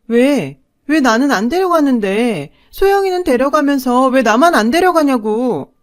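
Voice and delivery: defiant shouting. Acted. Daughter protests being left behind, loud defiant shouting. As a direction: shout back at mother, wounded and defiant. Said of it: "바락바락 대들며 소리쳤죠".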